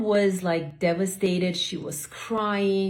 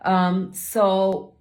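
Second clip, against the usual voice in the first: usual speaking voice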